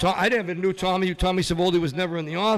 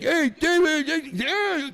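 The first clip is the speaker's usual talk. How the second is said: much higher mock call across the room; mock manager, much higher; 10 semitones higher, much slower than usual. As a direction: hail him from the ring, high. Imitating manager calling out.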